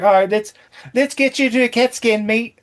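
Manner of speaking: imitating an actress's Australian accent, mate at the end. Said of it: "covering up her Australian accent".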